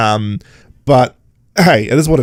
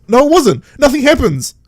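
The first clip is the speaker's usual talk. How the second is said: exasperated; 11 semitones higher than usual. Retold or imitated. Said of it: "I'm like".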